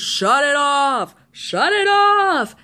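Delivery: slow, drawn-out mock yell, rising in pitch; imitating angry brother. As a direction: drag out each word like an exasperated brother. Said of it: "He's getting really, really angry".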